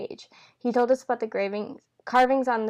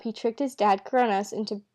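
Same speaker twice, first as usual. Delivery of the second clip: ordinary delivery, nothing marked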